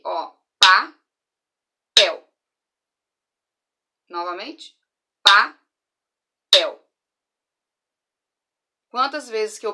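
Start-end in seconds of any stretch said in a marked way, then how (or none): 0.0-2.3 "papel" split into "pa-pel", slow and loud; syllable-clapped. Shown as a demonstration. Teacher clapping out syllables for the children, slow and loud. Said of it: "cada vez que a gente falar um pedacinho, a gente vai bater". 5.2-6.8 "papel" as "pa-pel", loud and split; syllable-clapped. Shown as a demonstration. Teacher repeating the word for counting, loud. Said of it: "a gente vai bater".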